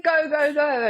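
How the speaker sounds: loud, high, urgent shooing; mock frantic command; retold manic urging. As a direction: act out hurrying someone along. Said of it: "it was so manic".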